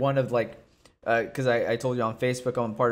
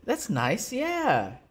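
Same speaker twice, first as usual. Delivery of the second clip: mock approval; 6 semitones higher, slower than usual. Retelling his reaction to Cruz's props, mock approval. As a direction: hand out gentle praise in a lilting voice. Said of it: "he was giving Team Alpha Male a bunch of props, and I was like".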